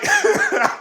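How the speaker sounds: short real laugh, very high; a quick squeal of laughter. Real, not acted. Host laughs retelling the blog quote, high squeal.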